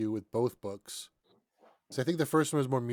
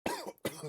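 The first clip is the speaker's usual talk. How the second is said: sudden choking cough; coughing fit; 6 dB quieter than usual. Real, not acted. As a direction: cough hard on a swallow of tea. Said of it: "Sorry, I'm choking on my tea".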